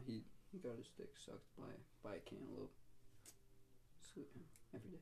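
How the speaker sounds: whispered, voiceless aside; whispered punchline. Real, not acted. Whispering the punchline to the co-hosts, voiceless.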